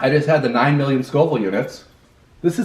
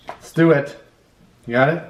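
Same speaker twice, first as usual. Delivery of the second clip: usual speaking voice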